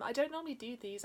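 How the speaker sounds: ordinary delivery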